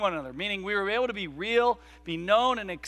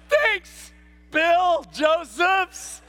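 much higher, very slow loud shout of the name; thrilled kid shout; 11 semitones higher, 8 dB louder, much slower than usual. Retold or imitated. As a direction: call out like an excited kid. Kid shouts thanks while leaving.

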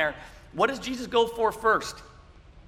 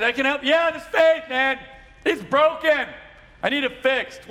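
higher, louder shop customer; playful skit voices; 7 semitones higher, 7 dB louder than usual. Pretend. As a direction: ham up a customer at the repair desk. Faith store skit, clerk and customer.

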